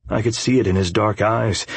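plain reading voice

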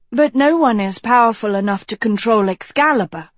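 rebutting. A narrator's reading. Girl disputing the wizard's theory, rebutting. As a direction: push back with mild protest. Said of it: "Agatha rebutted".

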